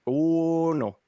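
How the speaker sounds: playful put-on Wisconsin accent; mock Wisconsin twang. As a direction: play a Wisconsin local with drawn vowels. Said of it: "Oh, the Wisconsin accent".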